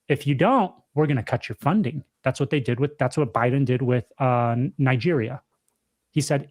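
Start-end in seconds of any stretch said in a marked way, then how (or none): none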